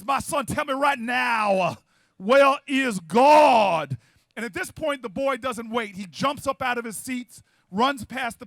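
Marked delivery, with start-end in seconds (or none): 0.0-4.0 booming baritone pastor yelling from the pulpit; slow, thunderous preacher building to a roar; Sunday-sermon yell. Acted. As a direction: stretch the final words out loud and long. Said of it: "he yells".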